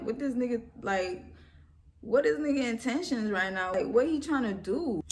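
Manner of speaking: rising alarm, voice climbing; retelling her panic in the car, nervous and loud. Retold or imitated. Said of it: "I'm getting nervous".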